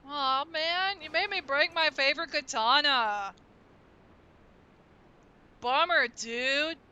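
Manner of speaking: laid-back surfer drawl; surfer dude voice. Pretend. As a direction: stretch it like a chill surfer. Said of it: "give him a surfer dude voice".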